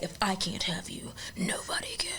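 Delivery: breathy threatening whisper; menacing whisper. Retold or imitated. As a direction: hiss it like a stalker. Stalker's threat retold.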